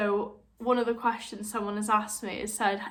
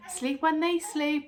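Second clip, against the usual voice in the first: higher, slow sing-song advice; mock advice; 6 semitones higher, slower than usual. New mums told to sleep, mock sing-song advice. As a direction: parrot the condescending advice. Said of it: "the most condescending thing that you hate being told".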